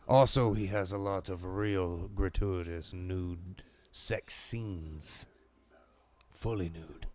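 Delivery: slow, grand villain with English touches; stately, drawn-out delivery turning almost English; Candyman drifting toward English accent. Acted. Candyman demanding gratuitous scenes, slow English-tinged pomposity. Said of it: "I almost changed him to, like, an Englishman".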